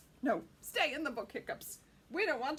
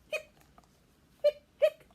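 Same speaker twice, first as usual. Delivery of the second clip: hiccup sounds; 15 dB louder than usual. Acted. Skeleton hiccuping.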